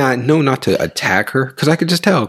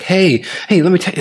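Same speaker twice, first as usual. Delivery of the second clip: acted eager, high-pitched pounce; excited greeting; 10 semitones higher, faster than usual. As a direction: burst in high with news to share. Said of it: "you're excited or the other person excited".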